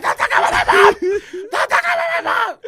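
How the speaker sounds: very high, loud screamed rage; losing it. Retold or imitated. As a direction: scream it like an enraged kid out of control. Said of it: "And I lost my shit".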